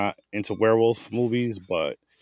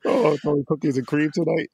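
high, loud mocking repeat; mocking echo; 6 semitones higher, 4 dB louder than usual. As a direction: throw his words back at him. Mock echo of co-host.

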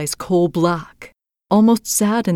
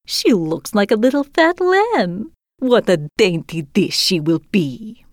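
put-on old robber woman; 3 semitones higher than usual. Old robber woman eyes Gerda hungrily, gloating crone voice. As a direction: savour each word like a greedy old hag. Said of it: "cried the old robber woman".

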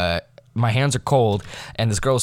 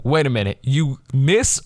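ordinary talk

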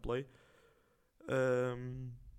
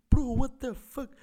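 high, loud, slow stunned outburst; shocked disbelief; 12 semitones higher, 10 dB louder, much slower than usual. Retold or imitated. Watching a strange TikTok live, loud and stunned. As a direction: let the "what" hang, pitched way up in shock. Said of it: "E eu, tipo".

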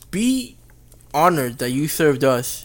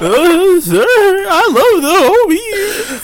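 squeaky, stretched out, laughing through it; very high, very slow put-on voice; 7 dB louder, much slower than usual. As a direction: drag it out in a high silly voice.

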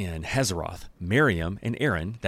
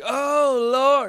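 wailing cry; 7 dB louder than usual. Retold or imitated. Aaron wailing.

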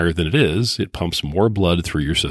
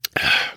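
breathy ahh after a sip; contented exhale; satisfied sigh. Real, not acted. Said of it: "Good stuff right there".

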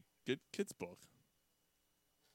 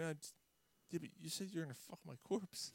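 soft, raised-pitch protest; acted hushed confrontation; mock hesitant disbelief; 4 semitones higher, 10 dB quieter than usual. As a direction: query it quietly, as if taken aback. Acting out the two men's exchange.

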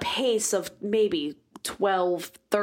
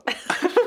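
burst of laughter; 11 semitones higher, 3 dB louder than usual. Real, not acted. Reacting to drunk friend story.

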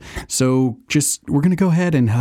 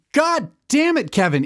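blowing up at a coworker; exasperated yell. Acted. Yelling at Kevin.

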